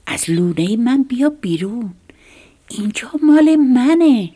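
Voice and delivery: high, loud cry to get out; loud shout. Acted. Little mouse ordering the snake out of its nest, loud shout. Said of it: "با صدای بلند گفت".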